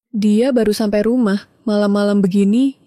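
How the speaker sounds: ordinary delivery, nothing marked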